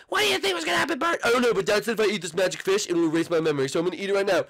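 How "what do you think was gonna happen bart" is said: high cartoon mom voice; sing-song motherly cartoon voice; mother greeting her son. Plays Marge the mom.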